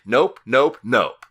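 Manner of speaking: loud put-on voice, refusing outright; flat refusal. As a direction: bark out a firm refusal.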